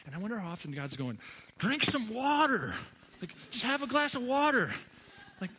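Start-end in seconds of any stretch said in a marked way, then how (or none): 1.6-4.7 exasperated God. Acted. God answering our prayers for patience, exasperated. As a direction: blurt it out at full volume, stretching the words.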